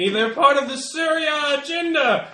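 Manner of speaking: high-pitched mock scream; acted screaming. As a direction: play a screaming monster, pitched well up. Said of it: "the other one is screaming".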